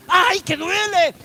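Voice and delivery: slow, drawn-out mock cry of hurt; acted cry of pain. Preacher acting out a listener struck by the word, crying out. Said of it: "te hace gritar".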